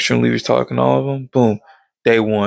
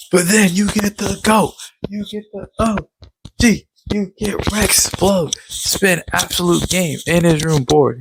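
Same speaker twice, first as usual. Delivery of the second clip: high, fired-up fan introduction; excited hype; 7 semitones higher than usual. Real, not acted. Fan introducing Rex Explode, excited and high-pitched. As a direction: hype up your favorite character. Hyping favorite character's entrance.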